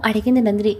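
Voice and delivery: usual speaking voice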